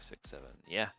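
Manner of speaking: ordinary talk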